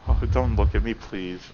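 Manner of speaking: lost in a feverish trance, murmuring; obsessed Yuri. Acted. Yuri ashamed.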